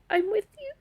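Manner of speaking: very high, choked-up pledge through real tears; crying. Real, not acted. Survivor retelling her pledge to a soldier, crying. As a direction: say it high, on the edge of sobbing. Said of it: "I'm gonna cry".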